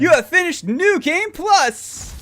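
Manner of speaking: announcer voice. Pretend. Announcing game completion.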